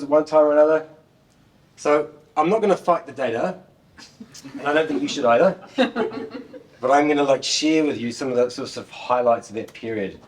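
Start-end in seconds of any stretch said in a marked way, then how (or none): 5.8-6.6 short laugh. Real, not acted. Laughs at his own joke.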